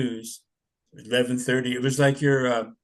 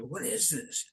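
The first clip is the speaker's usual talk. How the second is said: scared hush; 5 semitones higher, 11 dB quieter, slower than usual. Retold or imitated. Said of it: "I'm like".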